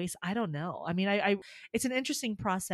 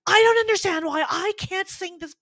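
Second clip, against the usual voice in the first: mock-frantic outburst, high and loud; hammed-up panicky yell; retold panic; 10 semitones higher, 6 dB louder than usual. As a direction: wail it like a singer melting down. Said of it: "I start to panic".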